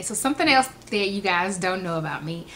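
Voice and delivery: normal talking voice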